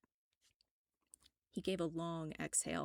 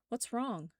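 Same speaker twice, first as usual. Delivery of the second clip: worried; 6 semitones higher, 3 dB louder than usual. Acted. Narrator spun around, worried.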